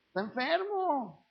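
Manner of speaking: slow, soft, pitying sigh of an excuse; mock pitying excuse. Preacher mimicking a relative who excuses a drunk, soft and pitying. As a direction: mockingly pity him as a poor sick soul.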